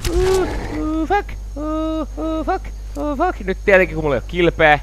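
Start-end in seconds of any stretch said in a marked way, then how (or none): 0.1-3.4 soft falsetto moans of dread; high panicked whimper. Real, not acted. Player in danger in game, high soft whimpering.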